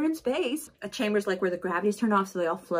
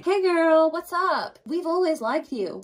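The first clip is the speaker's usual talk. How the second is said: fake friendly; 9 semitones higher, slower than usual. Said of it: "everyone is like".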